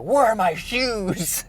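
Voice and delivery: high, slow bewildered question; confused surprise. Retold or imitated. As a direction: suddenly realise your feet are bare. Confused man notices bare feet.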